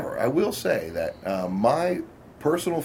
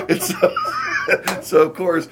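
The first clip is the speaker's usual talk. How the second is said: ordinary talk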